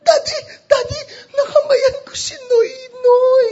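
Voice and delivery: high, loud squeal of an excited daughter; imitating thrilled daughter. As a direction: shriek the big news to Daddy. Excited daughter phoning her dad.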